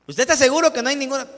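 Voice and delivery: loud, quick, pressing question; insistent question. Retold or imitated. Student pressing his teacher about the job, loud and insistent. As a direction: push the teacher hard for an answer.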